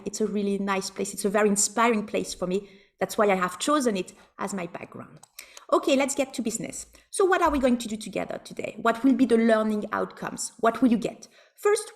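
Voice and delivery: ordinary talk, nothing marked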